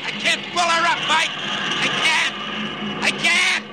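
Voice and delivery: high, urgent pilot's cry; desperate straining. Acted. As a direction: strain against controls that will not respond. Pilot losing control before crash.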